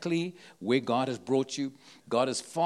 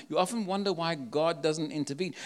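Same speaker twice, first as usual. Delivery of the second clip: usual speaking voice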